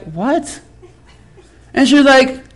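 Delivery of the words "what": soft, stunned question; taken aback. Retold or imitated. Pastor reacts to the woman's slight, taken aback. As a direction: drop to a hushed, baffled reply.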